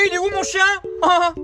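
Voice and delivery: ordinary talk, nothing marked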